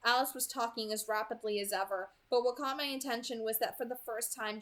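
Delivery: usual speaking voice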